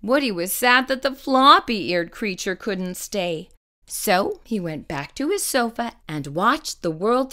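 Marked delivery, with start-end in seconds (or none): none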